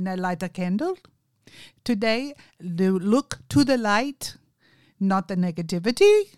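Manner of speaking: put-on loud Indian accent, phrase by phrase. Caller telling her to look to the light, Indian accent. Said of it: "he has a very strong Indian accent".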